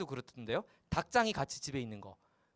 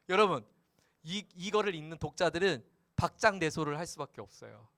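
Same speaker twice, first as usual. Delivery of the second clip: ordinary talk, nothing marked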